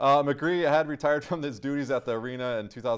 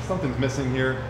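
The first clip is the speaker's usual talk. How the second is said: ordinary talk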